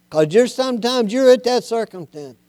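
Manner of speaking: ordinary delivery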